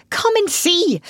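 high-pitched gleeful call; delighted. A narrator's reading. Rat calling mole to his find, delighted. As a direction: beckon with bubbling delight. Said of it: "said the delighted rat".

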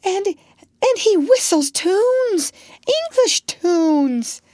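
high, slow, breathless excitement; an excited doctor's voice. Acted. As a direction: marvel at the news.

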